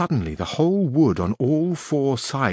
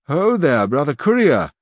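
raised call across the wood; 4 semitones higher, 5 dB louder than usual. A narrator's reading. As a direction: hail the rider loudly, lifting the pitch. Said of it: "cried the Duke".

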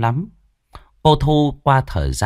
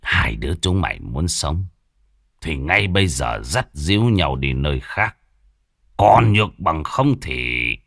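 low threat; 5 semitones lower than usual. Acted. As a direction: leave the threat hanging. Father gives ultimatum.

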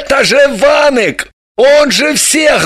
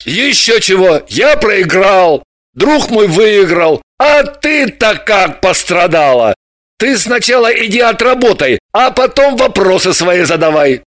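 put-on loud, offended drunk man; 4 dB louder than usual. Grablin, deeply offended, lectures Dalila. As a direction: bristle with injured pride. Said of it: "нешуточно оскорбился".

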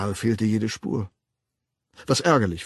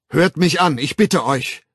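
loud, raised-pitch plea; earnest call; 6 semitones higher, 8 dB louder than usual. A narrator's reading. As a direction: beg the crowd to listen. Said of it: "rief der Wilde redlich". (laut und redlich rufend)

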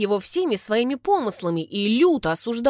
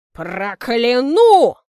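slow, louder sepulchral curse; grim reaper; 3 semitones higher, 9 dB louder, much slower than usual. Acted. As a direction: curse like a voice from the grave. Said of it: "страшным замогильным голосом".

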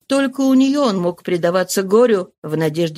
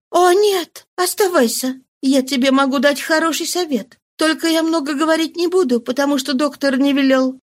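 quick, higher, eager answer; lively reply; 6 semitones higher than usual. Acted. Mistress urges him to stay, lively and higher. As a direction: brighten up, higher. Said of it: "Она отвечала с живостью".

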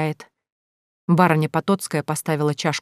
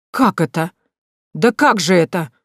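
flustered disbelief, growing louder; ошеломлённо; 4 dB louder than usual. A narrator's reading. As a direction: stammer out your astonishment. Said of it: "просто опешила от такого заявления". (растерянно, с возмущённым изумлением)

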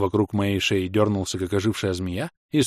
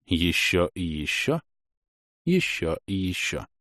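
slow repetition; 3 semitones lower, 4 dB quieter, slower than usual. A narrator's reading. Repeated spell strikes.

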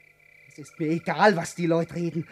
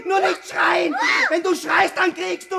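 furious shouting; 6 semitones higher than usual. Acted. Man furiously shouting at her to be silent.

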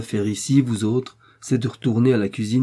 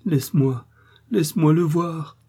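supplication; 7 semitones higher, 3 dB louder than usual. Acted. Woman pleads, trembling.

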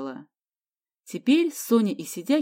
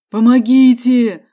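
long, loud, slow call for help; cry for help; 4 semitones higher, 13 dB louder, slower than usual. A narrator's reading. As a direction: yell for help from a far room. (громкий протяжный крик о помощи)

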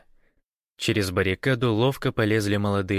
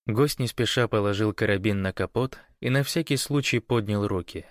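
ordinary delivery, nothing marked

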